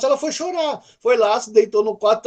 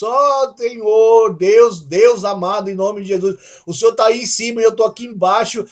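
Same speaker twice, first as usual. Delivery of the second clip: distant formal prayer; 2 semitones higher, 4 dB louder than usual. Retold or imitated. Preacher mocking a distant formal prayer to God. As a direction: intone it like a stiff formal prayer. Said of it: "é assim que você vai ser tratado".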